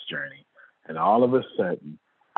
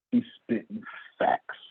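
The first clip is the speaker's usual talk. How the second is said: ordinary delivery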